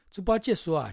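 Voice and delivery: ordinary delivery, nothing marked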